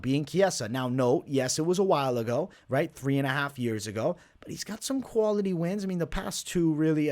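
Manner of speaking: normal talking voice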